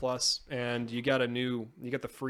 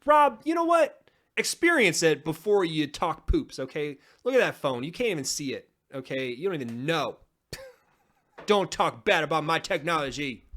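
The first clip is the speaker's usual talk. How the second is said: playfully offended rant; mock indignant; 4 semitones higher, 5 dB louder than usual. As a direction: defend your phone in mock outrage. Mock defense of his phone.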